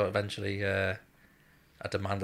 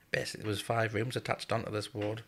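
ordinary talk

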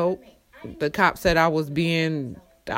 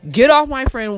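louder and higher, yelling at the cops; retold yell; 6 semitones higher, 6 dB louder than usual. To the cops holding her friend, a loud mock yell. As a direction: shout it as if stopping the arrest.

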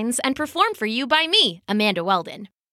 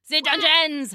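higher-pitched tyrant's cry; threatening king; 5 semitones higher than usual. Acted. King threatens the chanting crowd, high agitated outburst. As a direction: rant over the chanting crowd.

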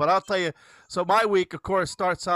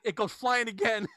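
ordinary delivery

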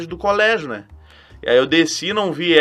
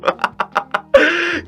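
high laugh; 12 semitones higher than usual. Real, not acted. Laughing at his younger self.

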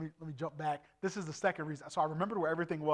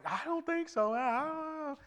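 high-pitched mock doubt; doubtful guides; 11 semitones higher, slower than usual. Preacher imitates guys doubting his memory, high doubtful voice. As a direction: mimic doubters in a much higher voice.

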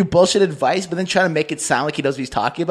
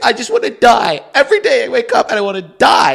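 mock despair; 7 semitones higher, 4 dB louder than usual. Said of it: "then she's like".

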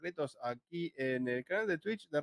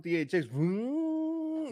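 mock sound effect; 8 semitones higher, much slower than usual. Imitating cinema sound logo.

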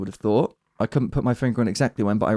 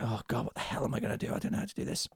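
panicked whisper; 14 dB quieter, faster than usual. Retold or imitated. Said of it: "Mando is like".

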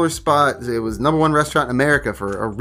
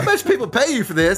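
higher, laughing wife voice; wife's voice; 8 semitones higher than usual. Retold or imitated. Wife teasing him about free trip planning, higher and laughing. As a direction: mimic the wife, amused. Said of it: "She said".

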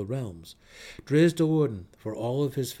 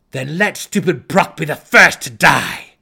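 loud, high menacing drow threat; furious threat; 5 semitones higher, 17 dB louder than usual. A narrator's reading. Drow threatening the goblin chieftain, furious loud threat. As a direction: scare them into flight.

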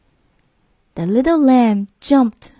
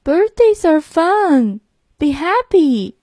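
higher, slow coaxing cow; 6 semitones higher, slower than usual. Acted. Cow urging the crying lamb, in a slow coaxing voice. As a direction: gently urge a sad friend to cheer up, slowly. Cow's voice in story.

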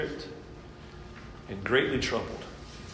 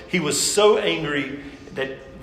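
ordinary delivery

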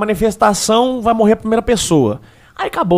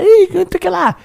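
mocking Bolsonaro; 12 semitones higher than usual. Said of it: "os Bolsonaro vai falar".

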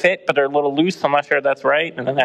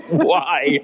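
laughing; 10 semitones higher than usual. Real, not acted. Bursting out laughing.

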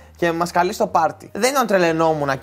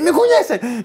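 high, raised imitation of a police order; mock shouting; 11 semitones higher, 4 dB louder, slower than usual. Officer orders the friend not to move, imitated high shout. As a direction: shout it like an agitated cop. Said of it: "Και του φώναζε και του λέει".

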